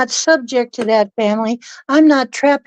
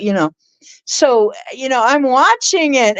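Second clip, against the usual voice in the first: normal talking voice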